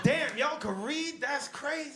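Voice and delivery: high, slow mock amazement; mock surprise. Mocking maga critics, high and slow mock amazement. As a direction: act stunned they can read.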